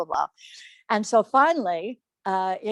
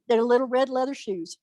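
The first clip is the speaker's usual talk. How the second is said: ordinary delivery, nothing marked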